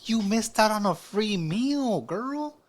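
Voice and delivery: high, slow, drawn-out disbelief; high-pitched incredulous chiding; exasperated scolding. Real, not acted. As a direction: scold her slowly, pitch climbing at the end. Scolding the woman in the video.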